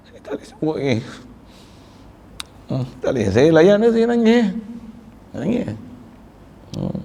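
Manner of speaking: choked up, fighting back crying; strained tearful voice cracking upward; real tears. Real, not acted. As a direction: voice breaking high as tears come.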